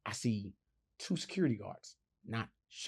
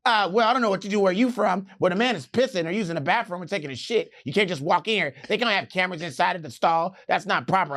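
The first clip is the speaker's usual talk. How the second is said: lightly mocking, affronted proper voice; 7 semitones higher, 6 dB louder than usual. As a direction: protest indignantly in a put-on proper voice.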